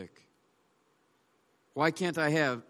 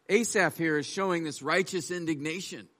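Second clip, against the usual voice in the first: ordinary talk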